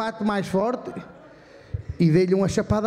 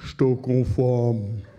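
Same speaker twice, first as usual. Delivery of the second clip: low, slow droning repeat; deadpan mimicry; 11 semitones lower, much slower than usual. Guy in the shop droning that he is hungry, low and slow. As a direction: drone it out flat and slow like a bored guy. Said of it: "dois gajos durante meia hora assim".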